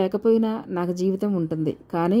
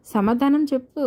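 higher, teasing insistence; playful demand; 6 semitones higher than usual. A narrator's reading. Karuna to Pradeep, biting his cheek: high playful demand. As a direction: press him for an answer, playfully.

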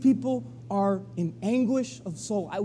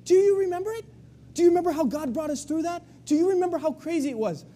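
much higher, urgent reminder of the Red Sea; eager reminding; 11 semitones higher, faster than usual. Retold or imitated. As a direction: fire them up with memories, quickening. Joshua rallying the crowd.

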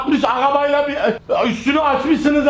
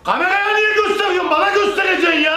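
angry shout; 8 semitones higher than usual. Real, not acted. Angry friend shouting at the prankster over his car. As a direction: yell at him, furious he's filming. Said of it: "Bağırırsan olmaz".

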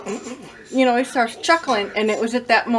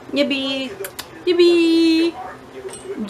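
cooing at cat; 5 semitones higher, much slower than usual. Real, not acted. Owner cooing at her cat Yippee, high baby talk.

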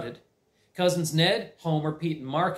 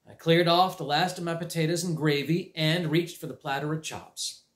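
usual speaking voice

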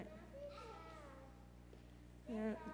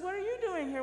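Mary and Joseph voice; 7 semitones higher, 5 dB louder, faster than usual. Retold or imitated. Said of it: "Mary and Joseph said".

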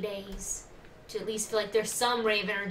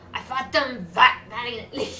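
game character voice; 8 dB louder than usual. Acted. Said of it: "that was still him".

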